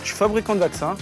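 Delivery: ordinary talk, nothing marked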